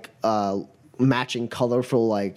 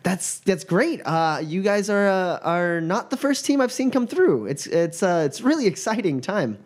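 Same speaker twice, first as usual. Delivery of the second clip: high, stammering fan voice; flustered student; 4 semitones higher than usual. Acted. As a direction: gush nervously over the famous team. GM voices Mila.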